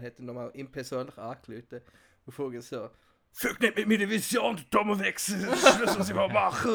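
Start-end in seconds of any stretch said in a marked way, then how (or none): 3.3-6.7 loud high furious rant, half laughing; high-pitched swearing tirade; angry [name]. Retold or imitated. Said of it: "Mit seiner richtigen Stimme".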